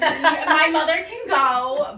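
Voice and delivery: client quote. Retold or imitated. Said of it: "And she was like".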